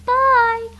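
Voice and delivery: high, loud, bright bye; excited goodbye. Real, not acted. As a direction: throw out a big cheerful bye.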